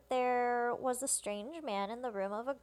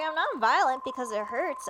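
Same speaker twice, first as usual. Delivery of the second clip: louder, emphatic mild exclamation; exclaimed protest; 3 semitones higher, 7 dB louder than usual. Retold or imitated. Woman objects to the twins because it hurts, mildly exclaimed. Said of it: "Exclamation mark".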